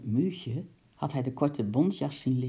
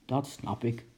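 low, soft grumble; grumbling; 4 semitones lower, 4 dB quieter than usual. Acted. Uncle grumbles to the lady while picking up pearls, low grumble. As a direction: grumble it low under your breath. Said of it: "bromde oom Nijltje".

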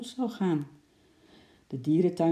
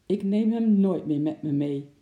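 louder, emphatic grandpa grumble; grumbling; 3 semitones higher, 6 dB louder than usual. A narrator's reading. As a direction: grumble it firmly, a touch indignant. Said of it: "mopperde opa Zand weer".

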